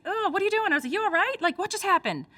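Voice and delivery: high, baffled what-are-you-doing; reacting in disbelief to a sudden fake cry; incredulous. Incredulous at a friend's sudden fake tears. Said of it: "I made this exact face".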